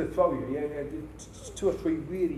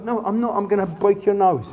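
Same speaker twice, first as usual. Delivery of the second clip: mock taunt; 5 semitones higher, 7 dB louder than usual. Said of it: "I go".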